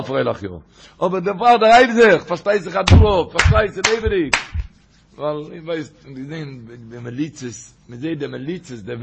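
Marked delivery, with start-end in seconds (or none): 1.0-4.4 loud, raised-pitch exclamation from a retold story; impassioned retold shout; acted outcry. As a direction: cry it out in forceful bursts.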